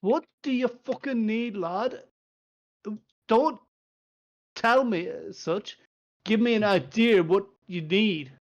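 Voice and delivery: he's had enough of the stalling; Doran's temper finally cracking; irritated dwarf. Acted.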